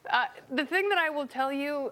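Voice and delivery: usual speaking voice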